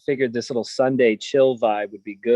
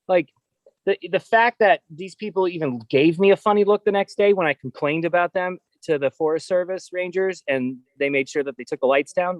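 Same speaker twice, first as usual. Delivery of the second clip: ordinary talk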